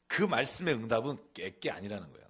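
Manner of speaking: ordinary talk, nothing marked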